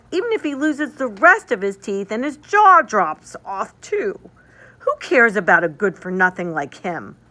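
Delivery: put-on high, loud sneering boy voice; scornful boy. Fritz mocks the broken Nutcracker, loud sneering boy. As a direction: sneer like a rude little brother. Fritz taunts his sister.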